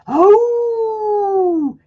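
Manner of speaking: a high hammed-up howl; dog howl. Teacher howling as the hound dog for the kids. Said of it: "rumbles to a mighty howl".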